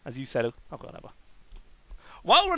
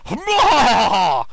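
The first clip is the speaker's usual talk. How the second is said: loud, drawn-out gloating "mwahaha"; villain cackle; 4 semitones higher, 8 dB louder than usual. Acted. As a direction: let out a hammed-up evil laugh.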